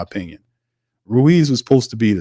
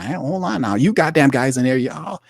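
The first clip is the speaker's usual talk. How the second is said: high, hammed-up berating voice; mock berating GM; 7 semitones higher than usual. Host imitating the GM berating players, high mock voice. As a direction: lecture the players like a pompous boss. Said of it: "he was in there berating them".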